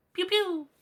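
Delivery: high pitched pew pew sound; cartoon gunfire sound effect; mock gunshots. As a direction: zap out a toy gun noise. Imitating gunshots in America.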